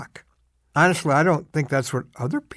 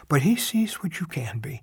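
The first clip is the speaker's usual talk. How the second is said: hushed whisper; 3 semitones lower, 7 dB quieter than usual. Real, not acted. Tender closing line of devotion.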